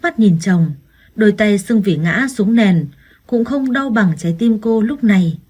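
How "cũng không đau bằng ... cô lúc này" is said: plain reading voice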